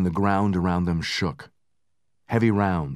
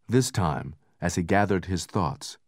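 usual speaking voice

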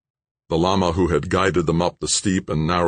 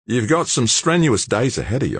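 higher and brighter, a big Aussie bloke talking up the night; young Aussie George; 8 semitones higher than usual. Acted. George's voice in the story.

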